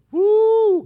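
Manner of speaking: high, loud whoop; excited whoop. Real, not acted. Preacher whoops at his congregation mid-sermon, high and loud. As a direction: let out a joyful holler.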